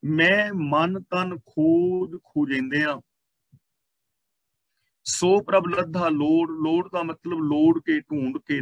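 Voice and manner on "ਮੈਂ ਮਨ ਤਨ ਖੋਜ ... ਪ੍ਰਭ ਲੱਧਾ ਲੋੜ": very slow, measured verse reading; scripture recitation. Real, not acted. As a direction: stretch each word of the sacred line.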